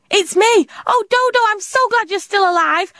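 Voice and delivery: high, excited relief; bursting with relief at finding Dodo; overjoyed. A narrator's reading. Tilly finds her friend alive.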